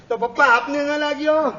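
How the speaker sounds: put-on the little boy turning the question back on his father; child's counter question. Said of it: "counter question આવશે". (નાના દીકરાનો ઊંચો, મોટો પ્રતિપ્રશ્ન)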